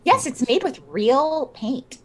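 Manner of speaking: high, eager pitch of a seller; defensive art seller. Acted. Player as Fiona defends the painting.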